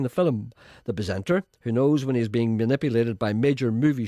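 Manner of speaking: loud, solemn narration of himself; grand announcer read, playing it straight; mock commentary. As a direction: narrate your own stoicism like a documentary. Mock third-person presenter commentary.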